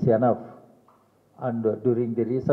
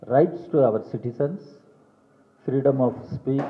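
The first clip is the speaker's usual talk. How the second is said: normal talking voice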